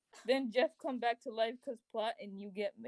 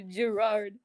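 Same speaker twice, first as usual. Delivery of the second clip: usual speaking voice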